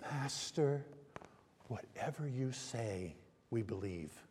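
very soft, low, reverent; hushed and slow, yielding to a master; quietly surrender, voice sinking low; humble submission. Acted. Voicing Peter's submission to Jesus.

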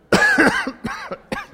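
a sharp high cough from trying to talk while inhaling; demo cough. Shown as a demonstration. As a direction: cough as if choking on the in-breath. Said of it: "Make you cough".

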